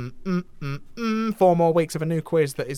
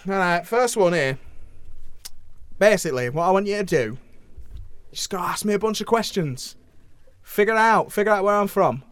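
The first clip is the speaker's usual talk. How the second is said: host playing a cheeky northern English voice actor; lightly put-on northern English accent, chatty and blunt; hammy northern English character; 3 dB louder, faster than usual. Host plays Nolan from North.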